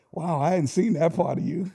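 ordinary talk

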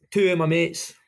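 usual speaking voice

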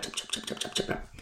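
whispered chop chop; softly voiced knife chops; chopping sound. Servant chopping onions for master.

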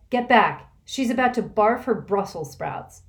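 loud, high urgent warning; urgent cry. Acted. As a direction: shout them out of the way. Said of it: "Kate cried".

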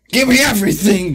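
loud, high-pitched quoted demand; retold yell. Guest re-enacting a wild night, retold yell.

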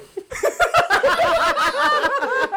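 long, very high laughing fit; high loud laugh. Real, not acted. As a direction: burst out in a high, sustained laugh. Cracking up at the botched proposal.

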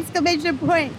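light hint of a Jersey Shore accent. Donna reading a glowing review in a light Jersey Shore accent. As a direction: read it like a mild Jersey Shore girl. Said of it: "a little bit Jersey Shore".

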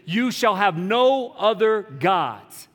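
slow, high, ringing commandment; commanding proclamation. A narrator's reading. Preacher quoting one of the Ten Commandments, slow and commanding. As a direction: lay down the law, stretching each word.

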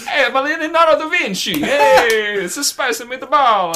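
loud, high cartoon Italian; hammed-up mock-Italian exclamation. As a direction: belt it out in a silly Italian accent. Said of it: "a terrible stereotypical accent".

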